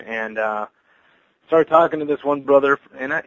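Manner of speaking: ordinary delivery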